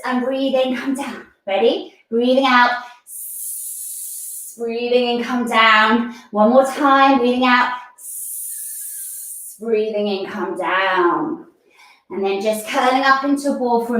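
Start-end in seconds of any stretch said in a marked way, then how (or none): 2.1-4.5 hissed breath out. Shown as a demonstration. Teacher demonstrates serpent breathing, hissed breath out. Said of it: "breathe out with a s". 7.2-9.6 hissed breath out. Shown as a demonstration. Teacher demonstrates serpent breathing, hissed breath out. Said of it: "breathe out with a s".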